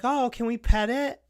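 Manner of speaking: put-on high, eager child voice; eager little girl. Little girls asking to pet the fake dog, high eager child voice. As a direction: squeak it up like an excited kid asking.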